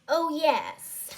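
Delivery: put-on a touch of prim English accent; proper English accent. A narrator's reading. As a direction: answer in a mild English accent. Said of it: "in the same accent".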